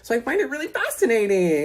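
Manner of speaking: high, mock-fascinated; pointed sarcasm. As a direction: feign fascination at a suspicious omission. Sarcasm about withheld information.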